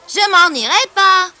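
high, loud, slow refusal; defiant boy. A narrator's reading. Bully refusing the lion's order, loud defiant boy voice. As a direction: refuse loudly as a stubborn boy. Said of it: "dit le garçon".

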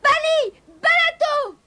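kitten's very high-pitched shout; crying out loud. A narrator's reading. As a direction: cry the spell in a piercing kitten voice. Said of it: "il cria le plus fort qu'il put".

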